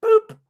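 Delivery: a sudden squeaky high-pitched boop, short and loud. Retold or imitated. Friend mimics the chat bot's high boop. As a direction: chirp it out like a cartoon beep. Imitating the ear trumpet bot.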